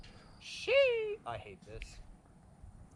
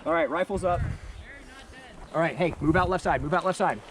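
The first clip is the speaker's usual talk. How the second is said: calling out; 4 semitones higher, 5 dB louder than usual. Real, not acted. Urging teammate forward.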